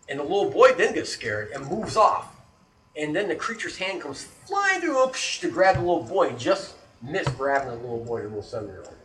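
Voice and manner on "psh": sharp "psh" of impact; sudden hissed crash noise; imitated crash. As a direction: mouth a smashing sound effect. Imitates hand crashing through.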